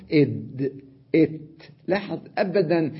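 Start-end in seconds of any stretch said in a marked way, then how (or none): 0.1-1.6 إد إت with the letters silenced; إد إت quick and isolated; letter sounds. Shown as a demonstration. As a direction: voice إد then إت to feel the place. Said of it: "عندما أسكن الحرف".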